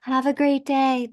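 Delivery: mock gushing flirt, high and bubbly; mock flirty gushing. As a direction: pour on the fake sweetness. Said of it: "giving off an energy going".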